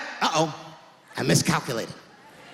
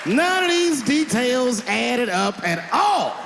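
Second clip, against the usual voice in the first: slow disbelief; 3 semitones higher, much slower than usual. Acted. Mocking the story.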